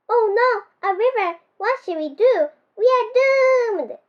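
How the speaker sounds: high, wailing mock panic, slowing on doomed; a scared penguin wailing that all is lost; shivering panic. A narrator's reading. Pete panics at the river, high and wailing. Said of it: "Pete said as he shivered more".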